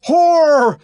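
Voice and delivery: enraged man shouting down at the woman; furious shout. Acted. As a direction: spit the word out in fury. John raging at Lenina.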